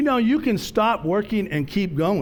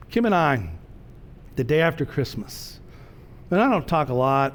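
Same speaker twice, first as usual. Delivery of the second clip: ordinary delivery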